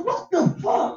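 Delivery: squeaky high, disbelieving exclamation; mock shocked yelp at a child's wisdom; stunned disbelief. Stunned by son's words.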